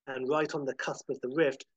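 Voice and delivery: ordinary delivery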